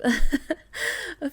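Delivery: a short happy laugh that breaks the sentence; fond little giggle. Acted. Giggles remembering fun times.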